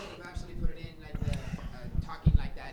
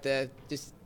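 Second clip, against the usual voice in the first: ordinary talk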